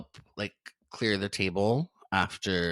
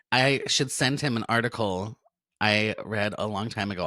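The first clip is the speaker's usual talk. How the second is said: usual speaking voice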